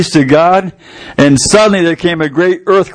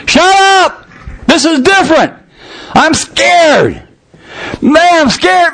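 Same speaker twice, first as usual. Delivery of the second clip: higher, frightened complaint; a mild mock-scared whine; scared prisoner; 8 semitones higher, slower than usual. Prisoner telling the singers he is scared, mock fear.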